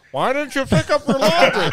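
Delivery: hammed-up dad impression; dad talking out of side of mouth. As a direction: talk out of the side of your mouth. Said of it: "talk out of the side of your mouth".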